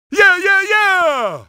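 victory cheer. Acted. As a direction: whoop with triumph.

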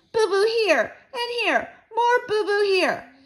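put-on high, loud toddler voice; Fudge showing boo-boos. A narrator's reading. Little brother showing all his wounds, high and babyish. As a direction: whine it like a hurt small child.

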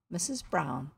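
usual speaking voice

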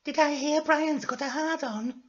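high, thin falsetto character; nosy question in a very high voice; Florence. Acted. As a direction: put on a high girly falsetto. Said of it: "said Florence".

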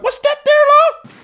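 very high, loud mimicked question; imitated Newfoundland lady. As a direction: mimic a woman shrieking at a passing mink. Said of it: "She's like".

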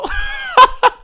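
high loud shrieking laugh; shrieking laughter at a joke. Real, not acted. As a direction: burst into a squealing laugh. Laughing at the ender idea.

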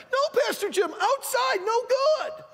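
put-on children yelling at the pastor; kids shouting. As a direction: bark the warning like excited kids. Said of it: "all the kids started yelling".

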